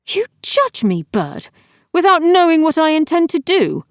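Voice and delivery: high, loud imperious challenge; imperious demand. A narrator's reading. Empress rebuking the falcon, loud and imperious. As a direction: command him like an empress. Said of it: "Nilith demanded imperiously".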